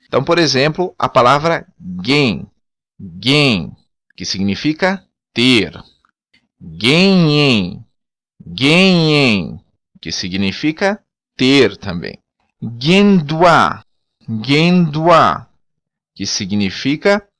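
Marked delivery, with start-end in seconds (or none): 1.8-3.7 gain with a hard g; gain, hard g and a nasal vowel; Creole word. Shown as a demonstration. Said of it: "tem o som de G, não som de G como em português". 6.6-9.6 ganhem with a hard g; ganhem, hard g and a nasal ending; Creole word. Shown as a demonstration. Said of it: "tem o som de G". 12.6-15.5 guendua with a hard g and final stress; guendua, hard g, last syllable stressed; Creole word. Shown as a demonstration. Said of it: "tem o som de G".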